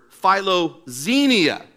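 "philoxenia" said slowly, syllable by syllable; carefully pronounced. Shown as a demonstration. Pastor teaching the congregation "philoxenia", slow and clear. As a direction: spell out "philoxenia" slowly for the room. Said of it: "the biblical word".

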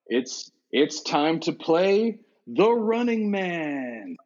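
game-show host's drawn-out title call; big, stretched-out showman intro; Eamon Killian. Acted. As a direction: announce the show slowly, rising high on the title. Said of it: "Richard Dawson as Eamon Killian".

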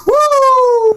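loud high whoop; excited whoop. Real, not acted. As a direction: cheer it out, big and high. Host cheers greeting new caller.

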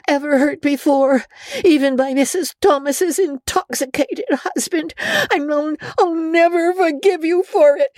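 high, crying, relentless anger; tearful tirade. A narrator's reading. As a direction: pour out every hurt in one rush. Anne's continued tirade at Rachel.

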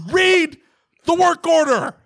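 very high, exasperated yell, broken after "Read". Acted. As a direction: scream it at the technician in mock fury. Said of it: "That's yelling".

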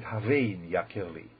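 "Havein Yakirli" slow, with a v in Havein; correct reading. Shown as a demonstration. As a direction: read "Havein Yakirli" as a question with a v. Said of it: "you have to read it".

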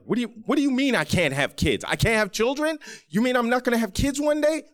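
high, rapid, panicked questions; frantic high-pitched disbelief at the doctor; teenage panic. Retold or imitated. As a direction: let the voice climb as you spiral. Fifteen-year-old panics at doctor's news.

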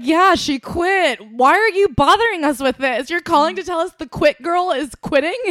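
bosses baffled why anyone bothers them; exasperated bosses. Retold or imitated. Company bosses scoffing at the tattling coworker, loud high disbelief. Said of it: "they would literally be like".

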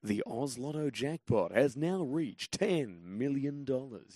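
big, slow, swooping announcer read; lotto commercial voice. Shown as a demonstration. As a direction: announce a jackpot with drawn-out showmanship. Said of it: "the voice of the Oz Lotto commercials".

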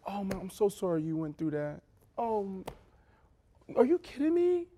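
high, soft gushing sympathy; mock sympathetic woman. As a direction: coo it like a caring friend in group. Imitating validating women in therapy.

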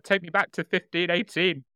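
high, fast excited dog voice; voicing the dog. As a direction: speak for the eager dog. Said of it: "Tank's like".

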